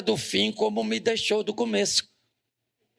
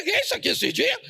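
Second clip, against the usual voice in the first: a much higher, incredulous question; mock surprise; 14 semitones higher, faster than usual. Pastor retelling his own reaction to the church, high mock surprise. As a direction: throw the question up high in disbelief. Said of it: "Eu falei".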